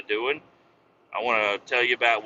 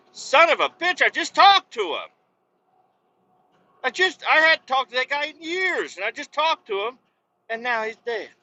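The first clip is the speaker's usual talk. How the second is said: high-pitched, strained outburst; mock-distraught high cry; acted outraged disbelief; 11 semitones higher, 4 dB louder than usual. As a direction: exclaim it high, as if you can't believe the news. Voices an upset reaction to death.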